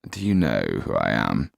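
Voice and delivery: low affronted question; haughty nobleman. Acted. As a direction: pull rank on the doorman. Kaliowski challenges doorman.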